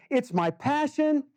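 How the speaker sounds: high, hammed-up earnest plea; mock earnest. Mocking people who say it's their passion, high and hammed-up. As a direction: parody someone claiming a calling. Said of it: "when someone says".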